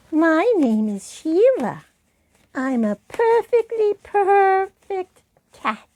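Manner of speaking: high, loud, self-satisfied cat puppet; cat preening about herself; vain cat voice. Shown as a demonstration. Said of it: "make the voice different from their own".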